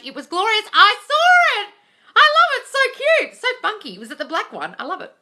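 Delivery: high, loud, delighted squeals; thrilled gushing. Real, not acted. Host squealing over a viewer's Starbucks cup win, loud and high. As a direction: squeal with joy over the news.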